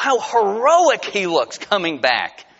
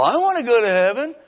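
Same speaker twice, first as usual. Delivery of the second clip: put-on eager kids; 7 semitones higher, 4 dB louder, faster than usual. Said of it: "And they go".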